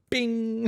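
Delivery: a quick high "bing" like a jolt; mock zing sound. Wife miming her nerve getting hit, high playful bing.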